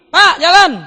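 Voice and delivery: high, loud call toward the house; shouted goodbye. Acted. Child leaving calls to father, high shout. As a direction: holler it to dad from outside. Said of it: "Teriaknya itu di depan rumah".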